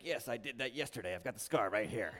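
low, very soft mock confession; hushed, low aside as an audience member; imagined listener's confession. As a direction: quietly own up, voice dropped right down. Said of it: "You're like".

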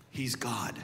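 breathy whispered reveal. Acted. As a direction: drop to a whisper for the truth. Hushed revelation after building point.

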